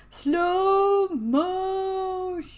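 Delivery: words stretched out very long, rising high; slow motion. Acted. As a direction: drag each vowel out like slowed film. Acts out the world slowing down.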